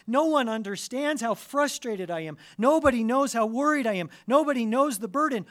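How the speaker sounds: high, loud, fast lament; anguished cry. Pretend. Preacher voicing a worn-out believer's outcry, loud and high. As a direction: cry it out like no one hears you.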